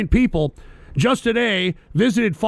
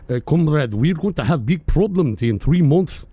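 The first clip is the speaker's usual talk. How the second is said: lower-pitched mock Russian voice; Russian accent; 5 semitones lower than usual. Host imitating Russia's central bank boss warning, Russian accent. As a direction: put on your best Russian accent, pitched down. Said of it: "let me try to do my best, uh, Russian voice".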